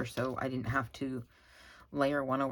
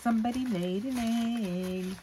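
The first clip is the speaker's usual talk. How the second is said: sing-song announcement; 7 semitones higher, 3 dB louder than usual. Acted. Echoing the hen's proud egg call, higher sing-song. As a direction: announce it like the hen would.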